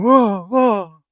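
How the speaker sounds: loud, high panicky whoa; hammed-up wobbling panic. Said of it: "he starts going".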